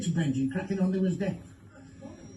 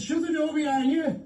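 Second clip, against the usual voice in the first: raised call; 8 semitones higher than usual. Acted. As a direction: call it out high across the room. Second deaf test, called louder.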